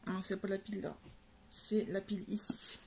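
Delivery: soft whisper to herself; whispered aside. Real, not acted. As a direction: whisper it while pointing. Muttering while sorting book piles.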